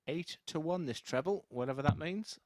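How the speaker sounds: ordinary delivery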